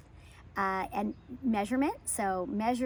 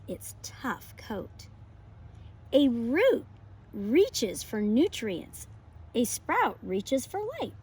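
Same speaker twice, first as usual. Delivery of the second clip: lively, expressive picture-book read-aloud; reading to children; 2 semitones higher, 4 dB louder, slower than usual. Acted. Teacher reading a pumpkin book to her class, bright and expressive. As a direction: read it like story time for little kids.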